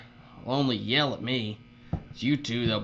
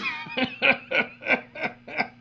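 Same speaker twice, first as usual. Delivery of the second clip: put-on high breathy cackle at the kid's expense; airy taunting laugh; mocking laugh; 11 semitones higher than usual. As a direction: laugh it off like a bully. [name] laughs at the kid.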